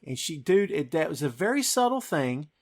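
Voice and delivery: normal talking voice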